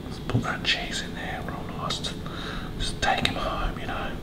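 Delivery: soft, intimate whisper. Pretend. Cook tucks cheese into the potato ball, whispering. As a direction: coax it gently in a whisper.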